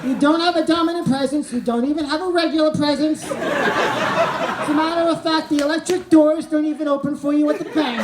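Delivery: the brain tears him down, jeering; brain mocking. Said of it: "my brain is like".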